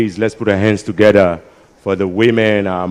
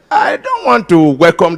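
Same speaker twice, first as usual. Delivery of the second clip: shouting; 7 semitones higher than usual. Real, not acted. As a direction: shout it out, fed up. MC rejects the idea.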